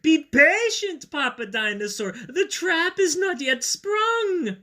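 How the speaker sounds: high, slow, loud villain voice; scheming dinosaur. Acted. Dinosaur telling Papa Dinosaur to wait for the trap, high and slow. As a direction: hold them back with sinister patience. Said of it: "sounded kind of like".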